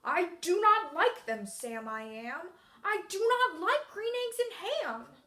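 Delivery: loud, swooping grumpy refusal; grumpy character voice. Shown as a demonstration. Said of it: "I'm using my character voice".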